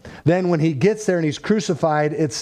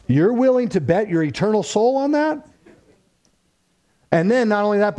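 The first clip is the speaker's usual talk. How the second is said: 1 urgent preaching; 6 semitones higher than usual. Acted. Challenges listeners directly.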